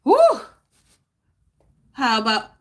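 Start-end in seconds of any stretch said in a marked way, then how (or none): none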